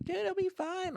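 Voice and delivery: high, light imitated girl voice; reassuring sister. Sister to scared brother, high-voiced reassurance.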